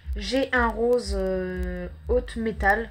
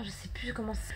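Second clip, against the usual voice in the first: muttered whisper to self; 9 dB quieter, faster than usual. Real, not acted. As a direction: whisper it while searching. Whispered aside while searching for word.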